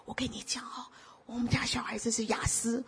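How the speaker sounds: confiding whisper; mother confiding in the pastor, whispering. Retold or imitated. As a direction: whisper it like a secret. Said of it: "有个妈妈跟我讲说".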